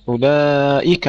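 أولئك chanted with a long middle vowel and clear final "ka"; open kaf. Shown as a demonstration. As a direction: end أولئك on an open, distinct fatha. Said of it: "افتح الكاف". (نطق «أولئك» بفتح الكاف بوضوح)

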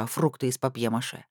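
ordinary delivery, nothing marked

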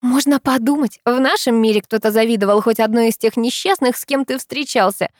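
high, scornful insistence; unrelenting protest. A narrator's reading. Girl disparaging the driver's past girlfriends, unrelenting. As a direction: keep scoffing, drawing out the final refusal. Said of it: "не унимается она".

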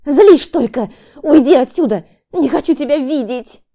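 high, very loud shout to get out; labouring wife orders husband out, shouting; angry shout. Acted. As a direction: drive him away in fury.

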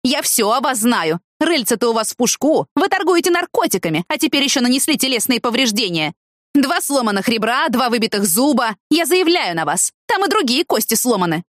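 high-pitched tirade of accusations; angry barking. A narrator's reading. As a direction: rant at him indignantly, pitched high. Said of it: "рявкнула она, размахивая руками".

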